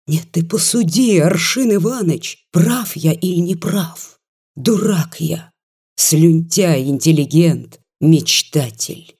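ordinary delivery, nothing marked

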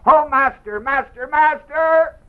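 high, loud, slow panicked call; frightened men wake their master; shaken crying out. Retold or imitated. Shook disciples cry to their master, high panicked plea. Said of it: "they got all shook and they said".

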